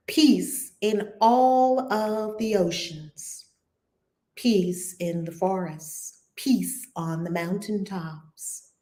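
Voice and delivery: hushed prayerful chant of peace; guided prayer. Acted. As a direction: lead them into calm, slowly with long pauses. Leads prayer meditation.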